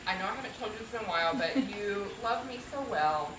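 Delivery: soft, sincere words to a husband; real heartfelt affection, hushed; tender tribute. Real, not acted. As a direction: speak quietly and lovingly to your spouse.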